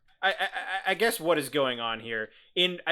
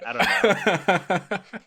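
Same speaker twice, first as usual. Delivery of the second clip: laughing; 6 semitones higher, 4 dB louder, much slower than usual. Real, not acted. Nervous laugh after joke.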